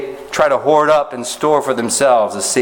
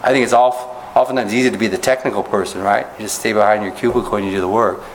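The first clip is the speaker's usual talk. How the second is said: ordinary delivery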